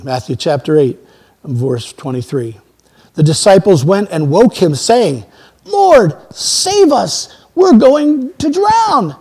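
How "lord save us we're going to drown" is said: high-pitched cry for rescue, drawn out at the end; acted desperate plea. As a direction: plead to be saved, voice raised high. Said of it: "they were far more emphatic than that".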